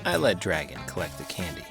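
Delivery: ordinary delivery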